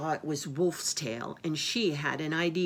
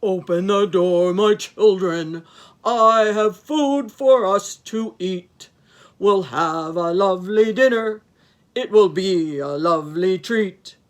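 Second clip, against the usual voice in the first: deep growly wolf; 3 semitones higher, 13 dB louder than usual. A narrator's reading. Wolf sings Mama Goat's song, deep growly voice. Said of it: "in his deep, growly voice".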